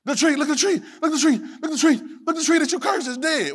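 high, eager pointing-out; excited disciples. Retold or imitated. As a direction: tug at his sleeve, amazed. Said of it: "The disciples get all excited".

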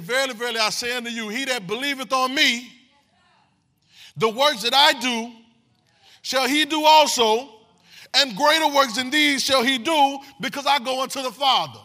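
full-force King James reading; preaching scripture. Acted.

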